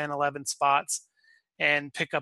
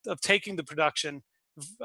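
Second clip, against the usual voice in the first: ordinary talk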